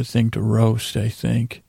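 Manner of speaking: ordinary talk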